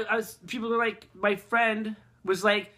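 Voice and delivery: usual speaking voice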